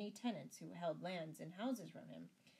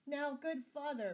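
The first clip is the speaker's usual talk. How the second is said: high, loud entreaty; begging; 9 semitones higher, 7 dB louder than usual. A narrator's reading. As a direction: appeal to the priest, begging. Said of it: "begged Sir Richard".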